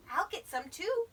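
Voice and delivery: high, loud little character voice; volunteer keenly as a little bear; eager Little Bear. A narrator's reading.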